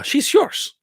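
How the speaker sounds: put-on lightly accented hero line, lifted at the end; mock action star conceding the bet; Van Damme impression. Repeating the bar line, light Van Damme impression. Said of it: "I'll do it in Van Damme's voice".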